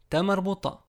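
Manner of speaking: ordinary delivery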